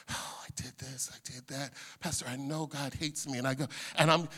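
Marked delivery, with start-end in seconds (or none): 0.1-3.4 confessing counselee. Retold or imitated. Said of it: "They're saying".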